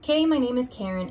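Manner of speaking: ordinary delivery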